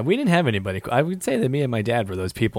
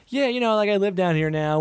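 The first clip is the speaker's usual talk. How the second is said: high, casual fan voice; a high, casual impression of an older Rangers fan; laid-back Rangers fan; 9 semitones higher than usual. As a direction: lightly play a relaxed older fan. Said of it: "who was just like".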